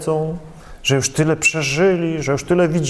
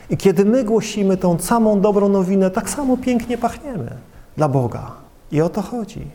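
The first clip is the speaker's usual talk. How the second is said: ordinary delivery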